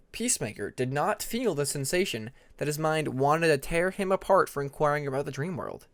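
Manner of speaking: plain reading voice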